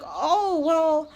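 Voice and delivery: high, bubbly fake-friendly voice; mock sugary saleswoman. Mocking the saleswoman who signed her up, high fake-cheery voice. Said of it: "then she's like".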